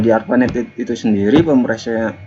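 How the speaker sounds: ordinary talk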